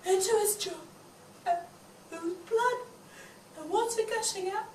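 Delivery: soft, stunned recollection; hushed and shaken. Acted. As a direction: say it quietly, still in shock. Quiet shocked recollection.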